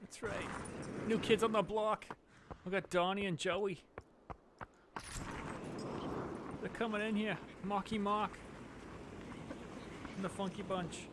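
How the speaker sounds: playful Boston local reeling off hometown names; hammed-up Boston accent. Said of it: "I did the Boston accent".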